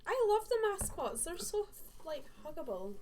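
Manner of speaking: high, loud, delighted gush; excited gush. Real, not acted. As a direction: squeal it with delight. Gushing about mascots.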